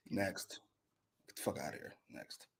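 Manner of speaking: very low, quiet brush-off; muttered dismissal. Real, not acted. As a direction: dismiss it under your breath. Dismissing the segment under his breath.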